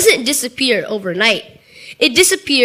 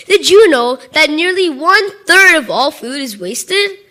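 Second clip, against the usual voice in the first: usual speaking voice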